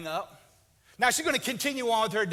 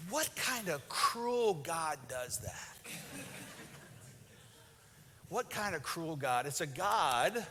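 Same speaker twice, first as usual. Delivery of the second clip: solemn preaching; 2 semitones lower, 4 dB quieter than usual. Acted. Pastor turns solemn about marriage.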